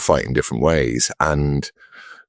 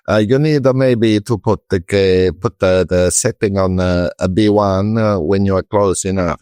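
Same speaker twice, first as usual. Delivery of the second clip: hesitant mock Italian team-radio accent, higher; Ferrari engineer's accent; 3 semitones higher than usual. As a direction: imitate an Italian engineer tentatively advising over radio. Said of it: "in his new Ferrari team".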